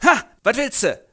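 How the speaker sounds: laut und höher, aufgeschreckt; erschrocken. Acted. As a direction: fahr ihn erschrocken an. Reinhold erschrocken, abwehrend. (Reinhold erschrocken zu Franz, laut)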